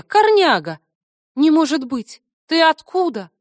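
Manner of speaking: high, loud joyful surprise; delighted shout of recognition; excited. Acted. Joyful surprise at meeting friend. (радостно и удивлённо)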